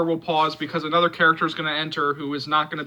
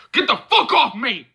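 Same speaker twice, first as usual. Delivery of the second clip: angry shout; 7 semitones higher, 6 dB louder than usual. Acted. Shoving off an attacker.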